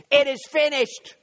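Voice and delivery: high, forceful cry imitating Jesus; preacher's loud call. Preacher imagines Jesus calling out, loud cry. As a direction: call it out from the pulpit. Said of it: "Jesus Christ called out and said".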